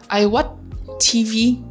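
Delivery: ordinary delivery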